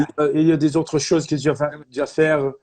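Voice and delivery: imitation Québécois French, rambling along; French spoken in a put-on Montreal accent; French-Canadian accent. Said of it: "I'm going French".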